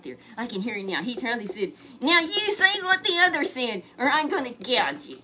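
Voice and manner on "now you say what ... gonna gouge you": high, loud mock threat; threatening messenger. Messenger bullying Micaiah, loud high threat. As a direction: lean in and threaten him.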